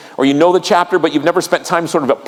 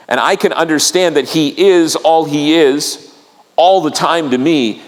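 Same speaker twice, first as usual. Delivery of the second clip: ordinary delivery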